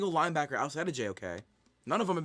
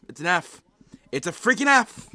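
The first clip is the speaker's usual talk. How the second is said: shouting it out, much higher and louder; angry outburst; 6 semitones higher, 11 dB louder than usual. Real, not acted. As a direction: blow up at the draft grade, real fury. Furious reaction to bad draft grade.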